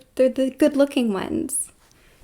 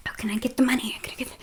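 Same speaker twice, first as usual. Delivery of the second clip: mock whisper; 5 dB quieter, much faster than usual. Mocking greedy humans in an aside.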